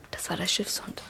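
hushed whisper, telling a ghost story; breathless storyteller's whisper; spooky whisper. Acted. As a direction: whisper it so the listener shivers. Boy tells eerie ghost story.

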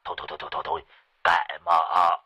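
mock-frantic old man; urgent pleading. A narrator's reading. Sorcerer urging his sleepy master, frantic. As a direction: hurry him, it's serious. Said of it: "nói như quát".

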